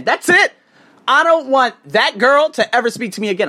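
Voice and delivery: high, loud insistent declaration; emphatic outburst. Real, not acted. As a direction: hammer it home loudly. Furious demand about the girl.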